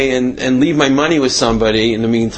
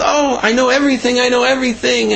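high-pitched, delighted imitation of a wife; happy wife; 11 semitones higher than usual. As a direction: voice a wife bubbling with good news. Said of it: "she's happy and smiling".

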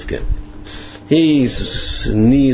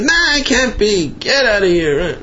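very high-pitched mock outrage; acted-out indignant refusal; scornful dismissal; 11 semitones higher than usual. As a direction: wave off the idea and shoo him. Retold Ziskin rejects message, ejects messenger.

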